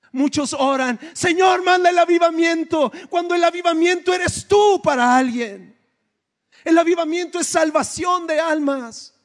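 rousing pulpit shout, pitch way up; preaching shout. Real, not acted. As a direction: thunder it out to the church. Preacher calling out to congregation.

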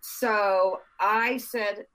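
normal talking voice

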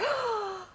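high, sharp intake of breath; re-enacted gasp of amazement; astonished gasp. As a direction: gasp as if stunned. Acting out own astonishment.

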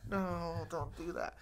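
acting out mild dread; mock dread. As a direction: plead weakly, stretching out the no. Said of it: "my biggest fear".

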